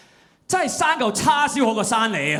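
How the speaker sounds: 模仿父亲的粤语骂声; imitating his dad. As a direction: shout it like a frustrated Chinese dad. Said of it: "he'd be like".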